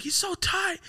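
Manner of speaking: put-on very high, gushing girly voice. Quoting [name] about [name], high and gushing. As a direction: squeal it up high like a starstruck fan. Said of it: "She's like".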